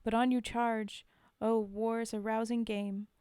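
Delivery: steady, earnest poem recital; poem reading. Acted. Reciting a sad war poem for her viewers, in an earnest reading voice. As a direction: read the verse straight and sincere, no accent.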